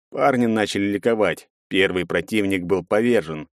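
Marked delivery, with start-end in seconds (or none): none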